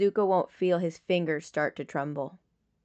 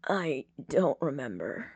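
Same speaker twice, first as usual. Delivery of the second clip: rasp; 5 semitones lower, 6 dB quieter than usual. Acted. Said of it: "he rasps".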